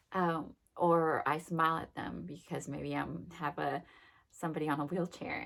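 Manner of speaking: ordinary delivery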